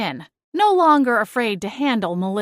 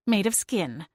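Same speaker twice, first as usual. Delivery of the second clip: ordinary delivery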